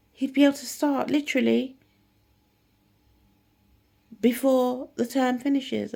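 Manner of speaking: high and loud, tumbling over the words; excited teacher. Retold or imitated. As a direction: gush the good news breathlessly. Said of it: "she gets so excited".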